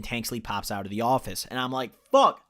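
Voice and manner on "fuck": high, loud retold curse; alarmed yelp. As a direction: blurt it out in sudden alarm. Retold alarm at seeing officer.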